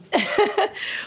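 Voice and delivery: a quick bright laugh; amused little laugh; short laugh. Real, not acted. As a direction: laugh lightly at your own remark. Laughs after her glow remark.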